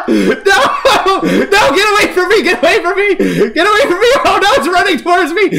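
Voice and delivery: high, loud frantic pleading; panicked screaming. Real, not acted. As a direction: shriek at it to keep away. Monster chasing the player.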